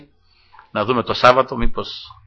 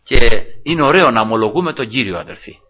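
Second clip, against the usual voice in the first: ordinary delivery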